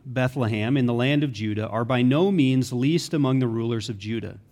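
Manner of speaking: ordinary delivery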